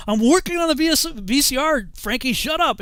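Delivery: fed-up owner shouting his answer at Frankie; exasperated shout. Retold or imitated. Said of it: "So I'm like".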